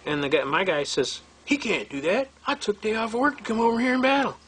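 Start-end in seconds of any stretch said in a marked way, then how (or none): 1.5-4.4 imitating an eager man, pitched up; eager protest. Client objecting that he took a day off, eagerly. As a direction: retell his keen protest in a raised voice.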